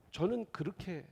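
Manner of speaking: usual speaking voice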